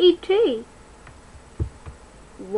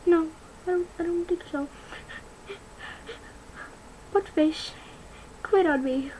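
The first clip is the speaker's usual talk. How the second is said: cat telling a story; 5 semitones higher than usual. Acted. The cat finishes the story about Marty, high and teary. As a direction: tell it as the sad cat. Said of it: "Let my cat finish the story".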